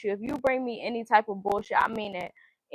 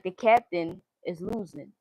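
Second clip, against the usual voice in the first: ordinary talk, nothing marked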